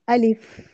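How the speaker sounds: अलिफ ending in an airy, whispered फ; alif with breathy f. Shown as a demonstration. Teacher models अलिफ for students, breathy final f. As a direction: let the फ of अलिफ breathe out audibly. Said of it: "फा की हम्स की भी sound".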